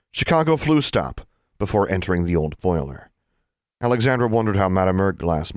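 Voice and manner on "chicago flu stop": high, ringing announcement; declared. A narrator's reading. Student calls out a destination at the furnace, declared. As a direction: call out the destination.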